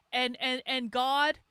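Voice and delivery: usual speaking voice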